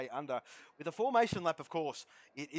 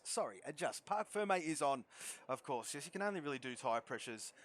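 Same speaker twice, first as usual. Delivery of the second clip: ordinary talk, nothing marked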